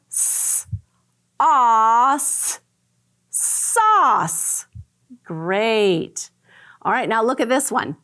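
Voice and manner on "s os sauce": segmented S, os, sauce; sounding out. Shown as a demonstration. Teacher modeling blending of sauce. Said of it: "Here I go".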